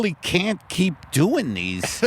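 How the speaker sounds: normal talking voice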